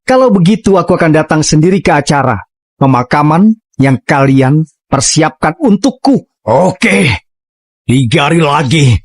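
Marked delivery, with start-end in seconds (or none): none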